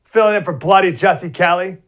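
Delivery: high, loud hammed-up British accent; playful fake British sign-off; mock British accent. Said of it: "I'll keep the British accent to Jesse".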